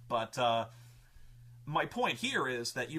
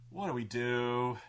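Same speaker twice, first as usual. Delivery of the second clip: mock bewildered; 5 semitones lower than usual. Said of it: "And we're like".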